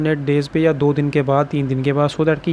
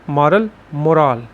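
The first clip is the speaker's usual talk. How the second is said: slow मॉरल stressed on "मॉ", then मोरल stressed on "रल"; slow contrast of the two stresses; slower than usual. Shown as a demonstration. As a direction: set the two words apart slowly, first syllable then second. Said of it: "इसको बोलते हैं मोरल". (धीरे-धीरे दोनों में ज़ोर का फ़र्क)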